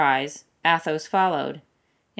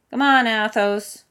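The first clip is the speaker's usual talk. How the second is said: raised, higher call; 6 semitones higher, 7 dB louder than usual. A narrator's reading. Porthos calling Athos to join them. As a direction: call him over across the camp. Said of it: "Porthos called".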